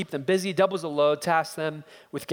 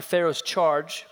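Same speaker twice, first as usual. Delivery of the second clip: ordinary talk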